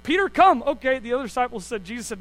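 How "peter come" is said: high, loud summons. Retold or imitated. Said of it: "[name]'s like".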